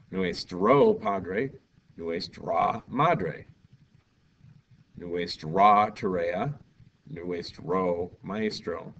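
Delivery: slow, careful teacher demo of each phrase; measured classroom modelling; slow model phrases. Shown as a demonstration. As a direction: say each pair slowly for the class. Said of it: "teacher models example phrases slowly".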